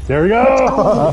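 drawn-out high victory shout; triumphant yell. Real, not acted. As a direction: cheer the win, stretch it out. Winning the match.

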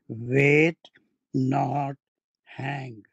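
"Wait" said loud, then "not" and "hang" spaced apart; pause after WAIT. Shown as a demonstration. Teacher dictating an urgent telegram, pause after "Wait". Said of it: "Telegram में punctuation नहीं आते".

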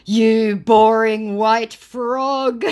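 very slow, loud, high mock insult; hammed-up angry jeer; loud scammer retort. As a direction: stretch every word out at full voice. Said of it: "your eardrums going".

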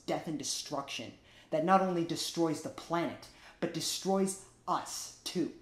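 ordinary talk

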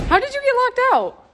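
high, loud disbelieving question; incredulous outburst. Real, not acted. Incredulous at locked-out boyfriend.

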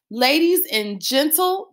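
slow, drawn-out showman tease; mock ringmaster stalling for effect; teasing announcer. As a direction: stretch it out to tease the family. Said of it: "I was going to tease them".

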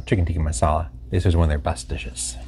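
normal talking voice

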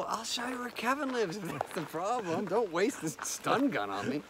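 high-pitched desperate bargaining; acted pleading. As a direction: play a comic plea to the attacker. Acts out pleading with attacker.

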